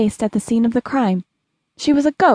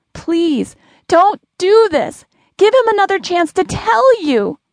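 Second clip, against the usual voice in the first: high, urgent, drawn-out begging; desperate pleading; 8 semitones higher, 3 dB louder than usual. A narrator's reading. Lily pounds on the invisible wall begging young Lily, high desperate plea. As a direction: plead hard, pitch leaping up. Lily begs her dream self.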